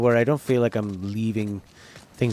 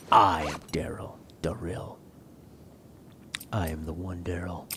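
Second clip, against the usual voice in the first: dark lord; 5 semitones lower, 9 dB quieter than usual. Pretend. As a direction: proclaim like a self-crowned dark lord. Mock dark lord proclamation.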